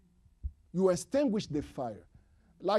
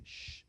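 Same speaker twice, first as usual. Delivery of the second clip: shushing; 15 dB quieter than usual. Shown as a demonstration. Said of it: "you say".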